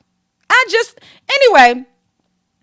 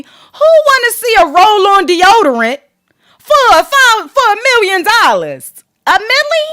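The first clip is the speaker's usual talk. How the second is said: incredulous mockery; 5 semitones higher, 4 dB louder than usual. Real, not acted. Mocking the million-dollar fee.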